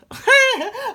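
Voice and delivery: a high, loud burst of real laughter. Real, not acted. Confessing anger at the dog, laughing high and loud.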